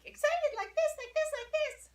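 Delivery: high-pitched, squeaky excited demo. Shown as a demonstration. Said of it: "I make my voice very excited".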